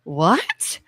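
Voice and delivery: soft, low start with a rising question; retold bewildered "what?"; baffled child. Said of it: "I didn't know what they were talking about".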